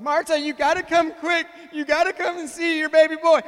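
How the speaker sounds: loud, high, hammed-up yell to the wife; calling for help. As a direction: holler for Mom in mock panic.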